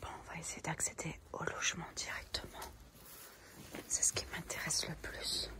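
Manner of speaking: hushed whisper, barely voiced; library whisper. Real, not acted. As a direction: whisper so nobody hears you. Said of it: "en discrétion absolue". (chuchotement discret)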